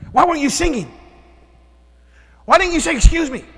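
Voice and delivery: father angrily berating son Zeke; angry yelling father. Retold or imitated. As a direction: shout at your son in frustration. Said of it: "That's not love".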